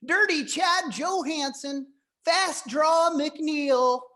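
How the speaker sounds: a California guy faking a Texas twang; makeshift Texas accent. Pretend. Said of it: "that little makeshift accent".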